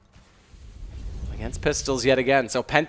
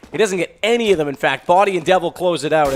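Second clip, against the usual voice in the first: high, loud esports commentary; urgent live match call, loud and high; excited play-by-play; 9 semitones higher, 10 dB louder than usual. Real, not acted. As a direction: call the misses at full excitement, voice pitched right up. Live play-by-play, missed shots.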